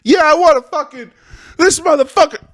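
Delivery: high, loud furious yell of a made-up caller; hammed-up screaming caller; enraged caller. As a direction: blow up like a caller ready to kill. Voicing enraged caller on hotline.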